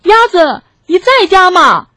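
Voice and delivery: high, loud calling out; wolf shouting at the door. A narrator's reading. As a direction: holler to someone inside the house. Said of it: "灰狼大喊".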